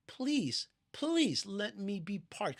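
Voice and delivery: imploring prayer; pleading. Acted. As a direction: draw out the begging. Acted prayer begging Hashem.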